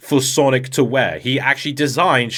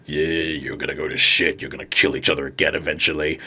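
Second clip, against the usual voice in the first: low, taunting villain voice, faster at the end; menacing villain; 9 semitones lower than usual. Retold or imitated. Dark Oak taunting Sonic, low sneering menace. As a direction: sneer the prophecy of doom.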